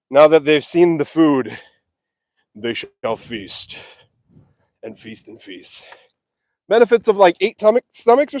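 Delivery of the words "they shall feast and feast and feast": slow, much softer mock-grand pronouncement; hushed mock-epic voice; mock solemn. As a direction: intone it like an epic narrator. Mock-grand remark on cows eating.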